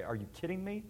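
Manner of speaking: mock-derisive "Are you kidding me?" with a rising "me"; imagined God sneering, mildly derisive; derisive scoff. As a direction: scoff at the listener in pretend derision. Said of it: "with a certain matter of derision and say".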